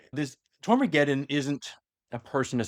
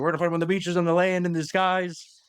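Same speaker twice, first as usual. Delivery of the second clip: Winston Churchill; 5 semitones higher than usual. Retold or imitated. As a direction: mimic a wartime orator, stretching the last phrase. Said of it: "Churchill, Winston Churchill".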